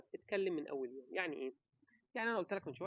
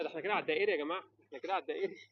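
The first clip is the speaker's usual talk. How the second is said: joking exclamation; 7 dB louder, much faster than usual. Real, not acted. Joking he's rambling on and on.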